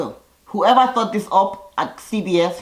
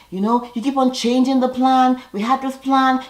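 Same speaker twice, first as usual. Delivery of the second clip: mock yelling; 7 semitones higher than usual. Lolo ranting at Tom and Kato, mild mock yell. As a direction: echo Lolo's shouting, understated. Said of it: "she's literally yelling".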